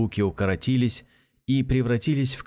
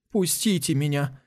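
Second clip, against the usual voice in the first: whiny plea, a touch higher; whining; 6 semitones higher than usual. Acted. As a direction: whimper it like a scared boy. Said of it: "захныкал Касмаля".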